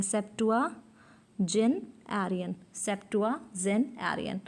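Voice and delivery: Septuagenarian very slowly in pieces, "septua, gen, arian"; broken into parts. Shown as a demonstration. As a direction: pause between each part of Septuagenarian. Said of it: "इसे आप break कर सकते हैं".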